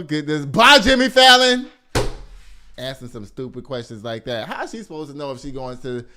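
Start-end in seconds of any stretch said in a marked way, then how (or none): 0.4-1.7 high, loud send-off; loud dismissal. Real, not acted. Host dismisses Jimmy Fallon over the question, loud send-off. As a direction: shout him away, high and loud.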